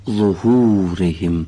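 ظهورهم stretched slowly, vowels held long; ظهورهم drawn out slowly, the long vowel held. Shown as a demonstration. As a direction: drawl the word ظهورهم. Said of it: "با صدای کشیده".

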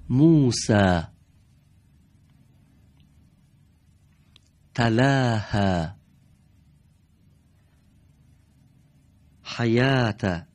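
slow, loud recitation of each word; model Quran reading. Shown as a demonstration. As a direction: read each word out clearly, teacher modelling for the class. (قرائت آهسته و بلند کلمات برای شاگردان)

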